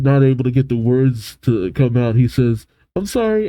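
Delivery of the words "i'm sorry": higher, flustered apology; embarrassed. Acted. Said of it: "Leo gets all embarrassed, uh, and flustered".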